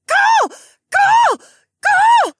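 piercing, very high, loud repeated cries; creature wail. A narrator's reading. Cromoth straining to fly to his owner, wailing. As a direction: wail like a struggling animal. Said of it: "the Cromoth wailed".

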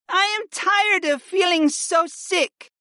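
very high, slow tearful wife; sniffling complaint. A narrator's reading. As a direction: lament it in a high female voice. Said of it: "Freya sniffed".

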